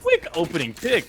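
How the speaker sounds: ordinary delivery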